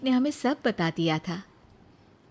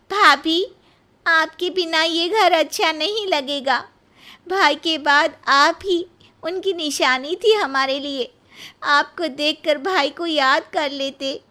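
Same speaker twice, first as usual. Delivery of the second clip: higher, louder, near tears; tearful sister-in-law; 8 semitones higher, 6 dB louder than usual. Acted. Sister-in-law begging Nisha to stay, tearful. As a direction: plead through rising tears. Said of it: "ननद रोने लगती है".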